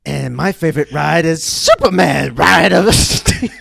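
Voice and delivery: high, slow, laughing performance voice; theatrical voice. Pretend. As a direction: overblown dramatic delivery. S1 performs dramatic intro bit.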